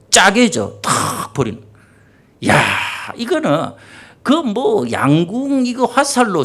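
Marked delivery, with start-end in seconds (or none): 0.1-1.6 짜개져 in Gyeongsang dialect, with an A for the O. Shown as a demonstration. Preacher retells the sea splitting in his dialect, Gyeongsang accent. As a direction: punch out 짜개져 like a Gyeongsang local. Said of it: "경상도 말로".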